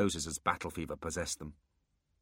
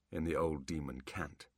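ordinary talk

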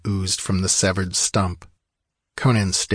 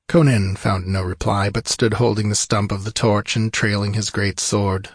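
usual speaking voice

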